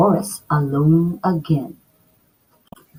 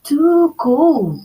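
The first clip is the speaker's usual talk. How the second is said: high, louder whiny complaint; complaining; 9 semitones higher, 5 dB louder than usual. Acted. Girl tasting cold porridge, complaining high. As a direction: grumble at the cold bowl. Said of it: "she complained".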